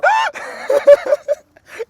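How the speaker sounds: very loud, high-pitched shrieking cackle; possessed laugh. Pretend. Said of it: "Si, je suis possédé". (rire de possédé, hurlé et suraigu)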